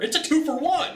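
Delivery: playful high squeal. Acted. Sales coach teasing viewers with a playful squeal. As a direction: jump way up high and playful on the pun. Playful pitch jump on pun.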